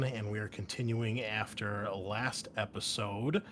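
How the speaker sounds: ordinary talk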